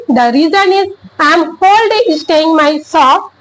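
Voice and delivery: ordinary delivery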